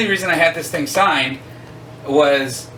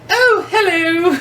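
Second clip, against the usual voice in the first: high, laughing British lady's "Oh, hello" in a slow, much higher voice; slow, sing-song British greeting, much higher and giggly; British accent; 6 dB louder, much slower than usual. Retold or imitated. Imitating the British actress greeting a fan, in a high British accent. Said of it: "what struck me is Barbara's British".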